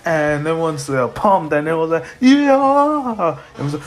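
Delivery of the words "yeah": high, loud drawn-out cheer; acted whoop of agreement; crowd cheer. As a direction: answer like a fired-up crowd.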